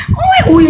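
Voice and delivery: very high mock baby crying owe; baby wail. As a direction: wail like a newborn, high and thin.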